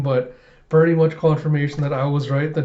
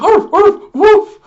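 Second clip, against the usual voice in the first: very high, very loud yappy barks; shrill loud dog yelps; frantic barking; 12 dB louder, much slower than usual. Retold or imitated. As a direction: bark like a panicked dog. German Shepherd losing it.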